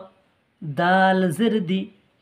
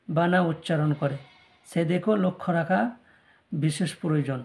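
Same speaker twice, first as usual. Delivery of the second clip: normal talking voice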